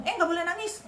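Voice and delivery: high-pitched mock telling-off; imitated scolding. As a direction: act out a grandparent forbidding tears. Voicing grandparent scolding crying grandson.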